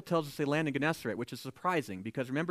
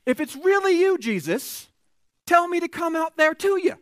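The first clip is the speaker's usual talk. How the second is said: high, raised voice calling to someone far off; acted calling out; 10 semitones higher, 7 dB louder than usual. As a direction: shout a challenge over the waves. Peter calls to Jesus from boat.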